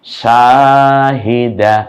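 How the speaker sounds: Shahida stretched syllable by syllable, each vowel held long; slow drawn-out reading. Shown as a demonstration. Teacher to learners, showing the joined word, very slow. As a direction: draw out Shahida slowly and clearly. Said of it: "মিলে কি হলো বলতে হবে এখন".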